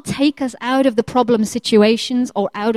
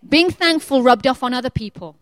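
ordinary talk

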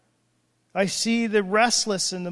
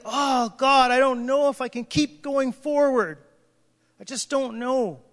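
weary plea; 4 semitones higher than usual. Retold or imitated. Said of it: "they're like".

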